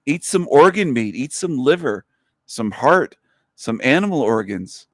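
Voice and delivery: ordinary talk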